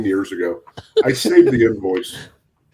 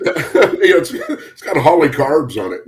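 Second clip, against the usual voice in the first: laughing; 5 semitones higher, slower than usual. Real, not acted. Joking answer amid group laughter.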